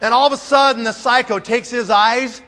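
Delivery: usual speaking voice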